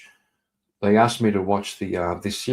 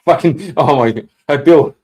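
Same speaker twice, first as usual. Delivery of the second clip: loud, sudden call to get Bill's attention; exasperated call-out; 4 semitones higher, 10 dB louder than usual. Real, not acted. Streamer calls out to chatter Bill, loud exasperated hail. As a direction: shout across the room at him. Calling out to a chat member.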